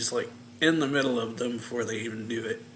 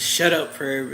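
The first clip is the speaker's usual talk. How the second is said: angry retort; 3 semitones higher, 8 dB louder than usual. Retold or imitated. Snapping at the pervy brother.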